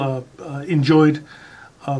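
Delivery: ordinary delivery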